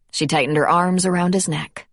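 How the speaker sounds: ordinary delivery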